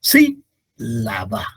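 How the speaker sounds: "Sí, la va" as SÍ... la-ba, slowly split; stress on first syllable. Shown as a demonstration. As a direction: punch SÍ, let "la va" drop low and slow. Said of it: "La sílaba tónica es sí".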